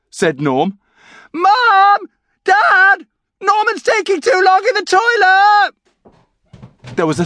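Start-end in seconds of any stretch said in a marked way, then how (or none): 1.3-5.7 very high, loud drawn-out bawl; tattling yell. A narrator's reading. Brian calling Mum and Dad to tell on Norman, bawling. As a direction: bawl for the parents downstairs.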